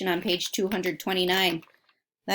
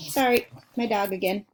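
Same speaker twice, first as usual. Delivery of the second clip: ordinary delivery, nothing marked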